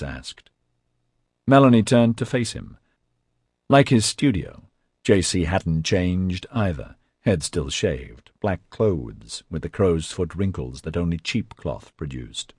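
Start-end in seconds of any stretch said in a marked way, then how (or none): none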